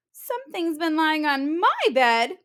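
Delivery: high cub voice, stress on my; small and alarmed; little bear. Acted. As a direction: cub pointing at his little bed.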